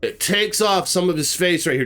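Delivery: ordinary delivery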